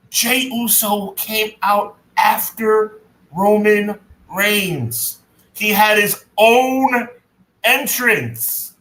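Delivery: slow, emphatic, phrase by phrase. Shown as a demonstration. Co-host making his point to Conrad, deliberately slow. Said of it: "let me say it real slow".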